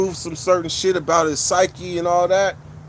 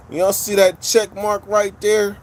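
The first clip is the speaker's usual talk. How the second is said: ordinary talk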